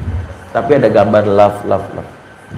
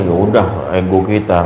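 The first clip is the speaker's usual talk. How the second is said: ordinary delivery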